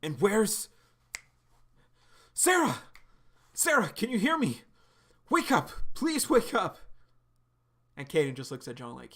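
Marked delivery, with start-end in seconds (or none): 0.0-6.8 high, loud desperate calls; panicked shouting. Acted. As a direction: beg her to wake up. John waking, panicking for Sarah.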